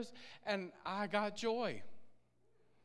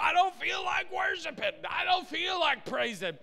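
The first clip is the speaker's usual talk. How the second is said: loud, higher, whiny protest; grumpy complaint; 10 semitones higher, 6 dB louder than usual. Retold or imitated. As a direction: shout out the sulky reluctance. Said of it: "just kinda like your worship".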